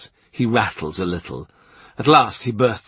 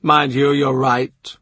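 matter-of-fact; 4 semitones higher, slower than usual. A narrator's reading. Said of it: "in a voice perfectly matter-of-fact".

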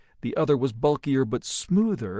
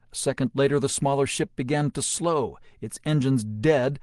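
ordinary delivery, nothing marked

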